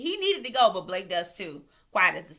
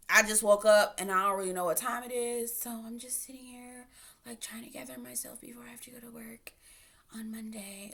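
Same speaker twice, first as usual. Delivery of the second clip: sleepy imitation; 3 semitones higher than usual. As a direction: mimic her drowsy just-woke-up voice in a whisper. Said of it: "She also gives sleepy".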